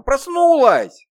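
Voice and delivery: high, loud joyful cry; child shouting with delight; overjoyed. Acted. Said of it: "восторженно".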